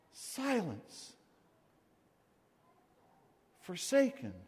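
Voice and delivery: soft, drawn-out hush after a long pause. Acted. Preacher stressing Jesus' forsakenness, hushed. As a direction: let the word fall into silence.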